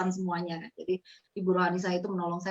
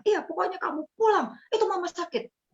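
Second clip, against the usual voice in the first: higher, pushy insistence; insistent order; 11 semitones higher, 3 dB louder than usual. Retold or imitated. Brother-in-law insists she come home, stern. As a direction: leave her no room to argue. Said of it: "Pokoknya bilang begitu".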